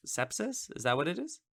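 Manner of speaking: Sepsis? asked high and hushed, doubting the word; checking herself mid-sentence; unsure question. Real, not acted. Vlogger doubting her medical term, unsure rising question.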